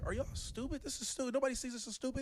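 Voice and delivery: high-pitched, exasperated disbelief; retold incredulity. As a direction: reenact being baffled that nobody else notices. Retold disbelief at racist film.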